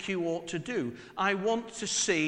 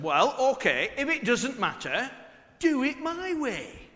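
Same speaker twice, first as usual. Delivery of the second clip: louder, higher and laughing, slowing on "do it my way"; smug dad; 8 semitones higher, 5 dB louder than usual. Retold or imitated. Father answering his daughter, smug and laughing. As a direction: win the argument with a smug chuckle. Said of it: "I thought I was being clever".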